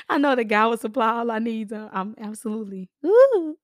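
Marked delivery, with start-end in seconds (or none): 3.0-3.6 woo. Real, not acted. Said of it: "forgive me for being hoarse".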